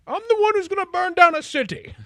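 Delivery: high, loud, hammed-up villain voice; mock villain boast. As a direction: ham it up like a melodramatic villain. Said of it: "being like".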